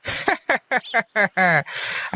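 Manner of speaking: a warm, open laugh at the teasing; short amused chuckle; hearty laugh. Acted. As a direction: laugh it off good-naturedly. Laughs at the lucky-harvest teasing.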